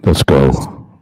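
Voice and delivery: very deep, forced low voice; forcing a deep voice. Shown as a demonstration. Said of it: "you can't talk deep like me".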